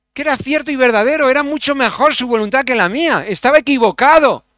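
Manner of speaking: high, loud and fast, full of fervour; fervent outburst. Real, not acted. Preacher testifying to the church, fervent and loud.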